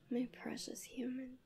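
tender whisper. Acted. Angel addressing her sleeping human, tender whisper. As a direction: breathe it over the sleeper.

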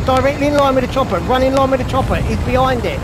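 high, loud urgent call; urgent shouting. Real, not acted. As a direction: call it out across the field. Directing teammate to the chopper.

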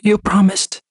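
put-on high, hurt little girl; tearful child. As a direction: reproach him gently through tears. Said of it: "added a whisper".

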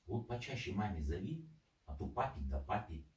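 usual speaking voice

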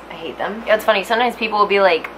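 usual speaking voice

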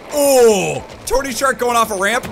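loud, high whoop into commentary; excited outburst. Real, not acted. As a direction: exclaim in amazement at the stunt. Excited at a ramp jump.

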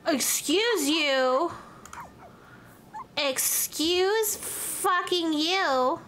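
slow, drawn-out offended retort; indignant excuse you. Acted. Streamer scolding the cat, slow indignant drawl. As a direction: stretch each word, offended.

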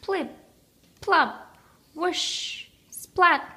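high playful splashy sound words; cooking sounds. A narrator's reading. Narrator plays the splashing batter, high and playful.

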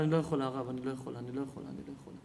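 low voice fading almost to nothing; anguished refusal. Retold or imitated. Mother tells the rabbi she cannot do it, anguished refusal.

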